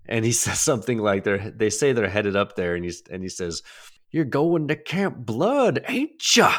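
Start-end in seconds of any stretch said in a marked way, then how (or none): 4.1-6.5 put-on high, slow cackling old coot; Crazy Ralph. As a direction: croak out a gleeful warning. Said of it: "my Crazy Ralph impression".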